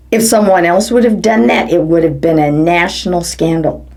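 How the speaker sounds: ordinary delivery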